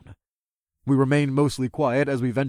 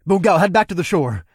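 calling out; 6 semitones higher, 6 dB louder than usual. Acted. Calling to dragon.

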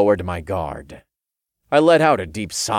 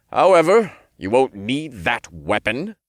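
higher, measured authority; stern archangel voice; 5 semitones higher, 4 dB louder than usual. A narrator's reading. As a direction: speak like a cool celestial judge. Metatron voiced.

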